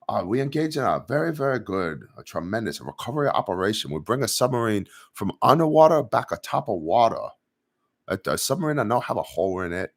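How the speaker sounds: broken English in a put-on Chinese accent; a hammed-up Chinese official bragging in a mock Chinese accent. Streamer fulfils chat's request in a Chinese accent. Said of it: "please say a recovery operation in a Chinese accent".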